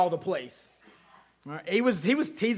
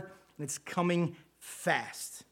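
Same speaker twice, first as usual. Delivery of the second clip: slow, much softer, drawn-out ending; hushed dramatic preaching close; dramatic slow warning; 2 semitones lower, 9 dB quieter, much slower than usual. Acted. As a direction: let the words land slowly and heavily. Sermon climax, dramatic slowdown.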